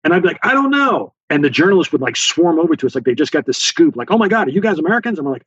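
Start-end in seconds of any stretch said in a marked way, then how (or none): 0.4-1.1 high, drawn-out mock reply; staged call back. Staged shouted reply for journalists. 4.1-5.2 imitating an eager reporter, high and fast; excited journalist. Imitating journalists swarming for a scoop.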